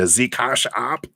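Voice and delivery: playful mock German accent. Reader thanking a client for a donation, playful German accent. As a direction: put on a jokey German accent. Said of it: "that's my German accent".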